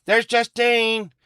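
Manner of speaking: high, slow called-out name; sing-song greeting. Real, not acted.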